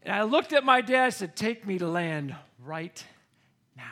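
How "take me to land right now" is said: stern retold demand. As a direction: space out the final words, deadly serious. Frightened child demands dad land.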